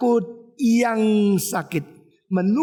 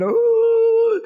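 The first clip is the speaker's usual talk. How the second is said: loud, high imitated crying; mock wail; 7 dB louder than usual. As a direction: wail like someone who cannot stop crying. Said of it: "orang kalau sudah nangis". (tiruan tangisan keras melengking)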